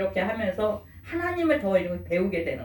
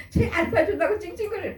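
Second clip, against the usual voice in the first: put-on high-pitched, amused child's tattling; lightly voiced child, pitched well up; imitated child; 9 semitones higher than usual. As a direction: report on another kid in a childlike voice. Imitated child reporting another's whining.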